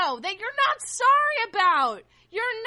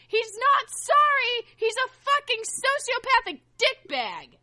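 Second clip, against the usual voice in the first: usual speaking voice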